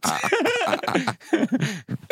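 a real laugh breaking out high; high burst of laughter. Real, not acted. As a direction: let the laugh burst out over the joke. Laughs at own crude joke.

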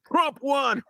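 high, loud mock shout of the slogan; mock yelled slogan. Host mimics the convict yelling after his sentence, high and loud. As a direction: bark the slogan like a defiant convict. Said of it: "raises his fist and yells out".

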